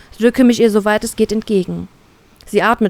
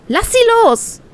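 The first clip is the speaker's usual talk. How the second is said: much higher, furious demand; aufgebrachte Forderung; 14 semitones higher than usual. Acted. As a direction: snap at the captain to let go. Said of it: "fordere ich aufgebracht". (hoch und aufgebracht gefordert)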